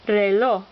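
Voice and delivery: reloj with LOJ stressed; stress on last syllable. Shown as a demonstration. Teacher showing examples of rule two, stress on last syllable. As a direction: land firmly on the final syllable of reloj. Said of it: "accent on the last syllable".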